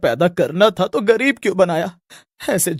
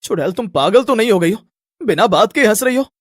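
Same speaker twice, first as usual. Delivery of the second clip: loud, annoyed challenge to the witch; angry young man; 5 dB louder than usual. Acted. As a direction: snap at her in a put-on boy's voice. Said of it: "वो गुस्से में बोलता है".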